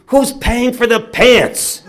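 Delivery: high, drawn-out retold bellow; father's angry demand. Father ignoring his son's plea to go, loud outraged demand. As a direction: bellow it slowly, ignoring the pleas.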